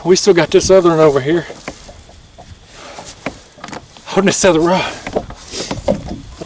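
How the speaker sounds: panting after the fight; puffing, winded from reeling; out of breath. Real, not acted. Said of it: "I'm out of breath".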